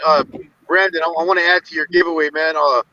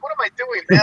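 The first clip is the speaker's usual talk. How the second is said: high mock exasperation. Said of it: "and I'm like".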